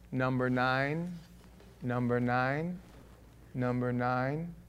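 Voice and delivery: low, slow monotone recitation; deadpan looped voice, pitched low; droning record loop. Retold or imitated. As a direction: imitate a repeating record, dragging the last word. Quoting the looped Beatles record.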